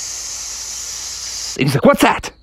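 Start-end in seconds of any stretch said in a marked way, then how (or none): 0.1-1.6 long soft snake hiss; a steady, quiet "sss" like static; mysterious hiss. Acted. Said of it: "all of a sudden he heard". 1.8-2.3 high-pitched alarmed yelp; startled question. Acted. Frog startled by a noise.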